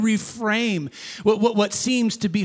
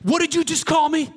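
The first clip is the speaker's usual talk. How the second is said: higher, indignant demand; mock angry retort; 8 semitones higher than usual. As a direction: play the angry gym guy, higher. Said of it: "he's just fuming".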